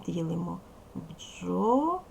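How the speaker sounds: бджола split slowly into бджо-ла; syllable split. Shown as a demonstration. Teacher showing the class how to split бджола. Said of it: "ділимо його на склади".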